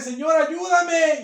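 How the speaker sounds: high, loud, slow plea; pleading cry. Acted. A believer crying to the Lord, slow loud pleading. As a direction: beg the Lord loudly, drawing it out. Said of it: "Como que usted esté".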